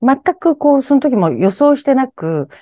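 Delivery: ordinary talk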